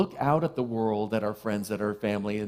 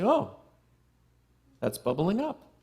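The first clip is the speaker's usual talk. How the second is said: mild mock surprise; 6 semitones higher than usual. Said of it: "I look at that and say".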